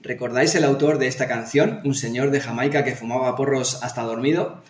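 usual speaking voice

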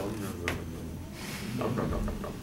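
low, quiet, mumbled student excuses; imitating a student muttering an answer so it can't be heard; mumbling student. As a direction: mumble like a student dodging the question. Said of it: "I've heard lots of".

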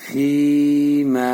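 ফি and মা each held long; spaced, drawn-out ফি, মা; one-alif stretch. Shown as a demonstration. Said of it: "এখানে এক আলিফ টান". (ফি মা, এক আলিফ টানে)

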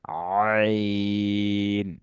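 আইন drawn out very long, pressed in the throat; কণ্ঠে চাপ দিয়ে টানা আইন; throat-pressed ain. Shown as a demonstration. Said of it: "নোক্তাটা মুছে দিই".

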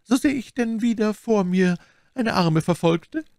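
high, pitying abbess voice rising into a question; growing sympathy. A narrator's reading. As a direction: ask with mounting compassion, pitched well up. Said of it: "mit steigender Teilnahme".

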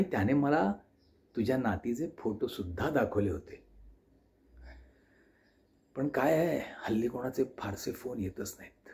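put-on soft, low and gentle old aunt; old aunt's voice. Elderly aunt admits to her nephew she stretched the call, softly. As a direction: speak like a tender elderly aunt confessing, quietly. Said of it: "आत्या बोलू लागली".

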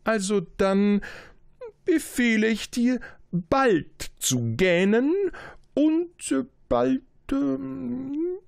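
high, loud royal command losing its thread; a king stumbling over his own order; flustered king. Acted.

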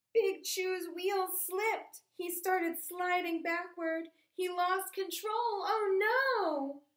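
gasp, then build to alarm; alarmed narration. A narrator's reading. Train slides back, alarm.

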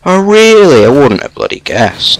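sarcastic. Real, not acted. Mocking the game's obvious hint.

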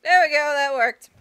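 loud pleased cheer; triumphant. Real, not acted. Puzzle solved, triumphant.

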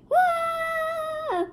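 high, loud amazed shout; a big high startled whoa; loud surprised whoa. Real, not acted. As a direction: gasp it out, amazed. Snake suddenly grows big.

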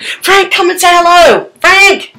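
high sing-song call across the house; calling Frank to come say hello. Real, not acted. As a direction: call her name up and out.

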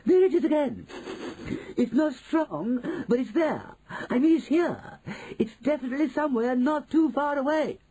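high, urgent shrieky voice; excited witch. Acted. Witch tracking a smell, high urgent excitement. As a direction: call it out high as the scent comes.

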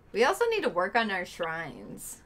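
ordinary talk, nothing marked

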